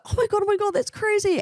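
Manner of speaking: very high, put-on scandalised squeal; high-pitched mock astonishment; mock shock. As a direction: imitate the crowd's breathless overreaction. Mimics everyone's shocked overreaction.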